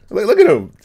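ordinary talk

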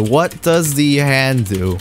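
"What does the hand do" drawn out low and slow; slow, deliberate repeat. Real, not acted. Player puzzling over "the hand", slow deliberate repeat. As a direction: stretch each word of "what does the hand do", pitched low.

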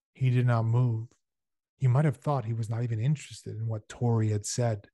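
plain reading voice

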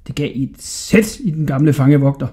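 plain reading voice

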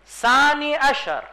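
ثانی عشر drawn out slowly, word by word; slow, careful ثانی عشر, each syllable clear; reading the Hebrew. Shown as a demonstration.

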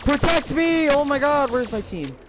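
high, loud cry for help; panicked shout. Real, not acted. Player calling absent team to protect them, panicked shout. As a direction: yell desperately, easing off at the end.

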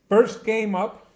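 loud, high-pitched matchup intro; hyped sports announcer. Real, not acted. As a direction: proclaim the team like a ringside announcer. Hyped announcement of the first game.

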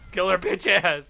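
loud urgent yell; shouting. Real, not acted. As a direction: yell it at the team. Urging teammates in fight.

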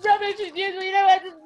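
high, loud hammed-up huff; pretend-wounded artist giving up in a huff; mock sulking. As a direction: huff it out like a sulky child. Pretend sulk over rubbish painting.

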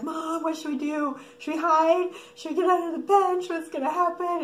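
put-on high, loud, panicky kid voice; imitated freaking-out kids. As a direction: pile up questions like kids in a panic. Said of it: "all the kids are freaking out".

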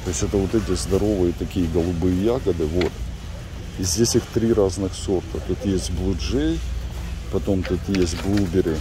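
ordinary talk

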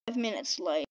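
deep, soft storyteller aside; low, quiet narrator voice. A narrator's reading. Child narrates time skip in play.

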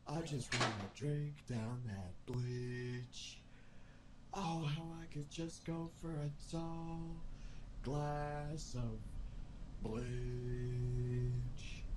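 slow, low, hungry crooning; low, slow creepy craving. Acted. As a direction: drawl it like a creepy addict. Hammy bleach-craving monologue.

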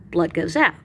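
ordinary talk, nothing marked

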